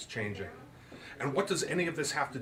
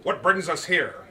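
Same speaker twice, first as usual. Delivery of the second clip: higher, louder, slow puzzled question; puzzled father; 6 semitones higher, 8 dB louder than usual. Acted. Andrew to Lizzie at the League doors, puzzled. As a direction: ask it baffled and slow. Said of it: "Andrew asked with a puzzled frown".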